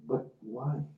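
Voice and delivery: understated baffled question, softly voiced; dumbfounded. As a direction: ask softly, imitating someone taken aback. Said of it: "Dumbfounded, he said".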